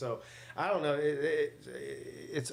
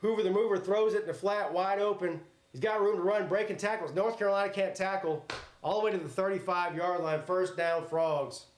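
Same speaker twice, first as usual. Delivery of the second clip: play-by-play; 4 semitones higher than usual. Acted. Mock play-by-play of game.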